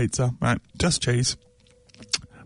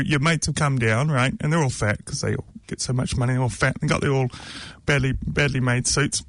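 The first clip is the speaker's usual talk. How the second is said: normal talking voice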